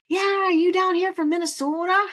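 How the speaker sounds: put-on high, loud holler in a thick Minnesota accent; imitated shout across street. Host imitating a guy shouting across the street, mock shout. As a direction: call out like a passerby hailing someone far off. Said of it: "he started shouting across the street".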